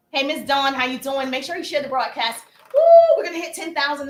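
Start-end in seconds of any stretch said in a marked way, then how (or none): 2.7-4.1 excited cheer. Real, not acted. Cheering the live stream's goal.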